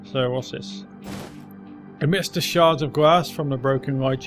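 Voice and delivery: ordinary delivery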